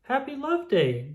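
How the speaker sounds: cheery greeting. Acted. Daniel greets reader brightly.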